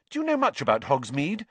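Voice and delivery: high, quick eager question; lightly voiced eager schoolgirl; keen. A narrator's reading. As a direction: ask with keen interest. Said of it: "asked Hermione keenly".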